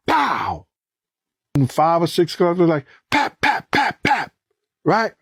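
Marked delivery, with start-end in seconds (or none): 0.1-0.7 a sharp spoken gunshot noise; imitated gunshot. 3.1-4.3 high, quick popping gunshot sounds; imitated gunfire.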